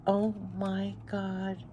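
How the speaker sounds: slow, very soft, stunned murmur; overcome. Real, not acted. Reader moved by the message from spirit, softly overcome. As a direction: say it slowly under your breath, overcome.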